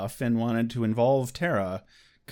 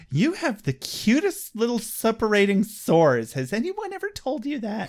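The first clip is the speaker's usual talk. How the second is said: cooing villain; 7 semitones higher than usual. Acted.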